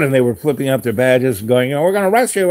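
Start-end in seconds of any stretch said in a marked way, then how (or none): 1.7-2.5 higher-pitched imitation of arresting officers; lightly acted official threat, voice raised in pitch; mock inspectors' threat. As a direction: play an inspector announcing a bust.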